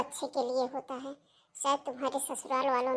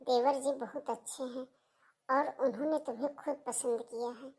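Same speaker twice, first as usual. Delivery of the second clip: soft, lower-pitched reassurance; gentle persuasion; 3 semitones lower, 6 dB quieter than usual. Acted. Sister-in-law coaxing Seema to accept marriage, gentle. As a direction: reason with her quietly and tenderly.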